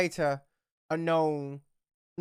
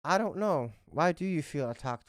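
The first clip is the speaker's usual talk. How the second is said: ordinary delivery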